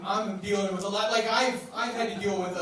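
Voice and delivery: ordinary delivery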